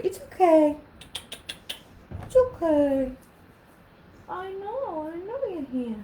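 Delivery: high, cooing pet voice. Real, not acted. Vlogger pausing the story to soothe her dog, cooing. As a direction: soothe the little dog gently.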